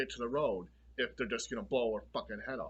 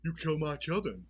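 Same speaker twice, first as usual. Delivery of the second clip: psycho mom, higher; 5 semitones higher than usual. Retold or imitated. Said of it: "she's like".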